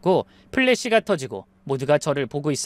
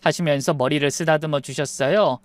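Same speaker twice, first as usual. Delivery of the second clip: ordinary delivery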